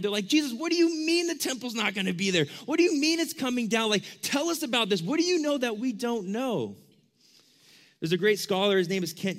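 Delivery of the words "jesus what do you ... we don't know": high, urgent, incredulous questions; raised-pitch bewildered protest; shocked disbelief. Acted. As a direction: press the teacher for answers in acted alarm. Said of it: "the exact way the disciples responded".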